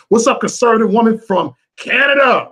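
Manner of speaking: high, loud hype greeting; excited shout-out. Real, not acted. Host greets a viewer from Canada, excited shout-out. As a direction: call it out like a hype man.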